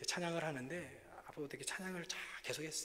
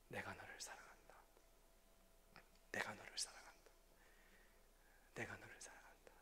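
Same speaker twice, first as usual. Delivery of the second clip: lower, unvoiced loving whisper; God's whisper; 7 semitones lower, 15 dB quieter than usual. God answering the sick pastor, lower hushed whisper. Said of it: "하나님 제게 말씀해 주신".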